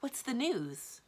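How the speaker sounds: eager question. A narrator's reading. Magician asks the monster for news, eager. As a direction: ask slowly with eager anticipation. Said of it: "he said eagerly".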